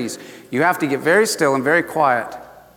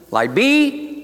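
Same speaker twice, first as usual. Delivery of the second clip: high, brief command on BE; God's creation command; 7 semitones higher than usual. Retold or imitated. Preacher re-enacts God creating light, commanding. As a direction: toss out a raised creative command.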